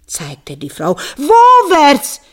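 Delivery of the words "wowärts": loud, high desperate question; demanding. Acted. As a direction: demand which way, frantic. Husband demands the direction. (Mann fragt verzweifelt laut nach der Richtung)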